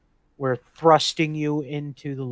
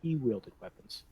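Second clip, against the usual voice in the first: soft, lower recollection; hushed in-character reply; 4 semitones lower, 9 dB quieter than usual. Acted. Adventurer answering the holy man, hushed. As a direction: keep it low and measured.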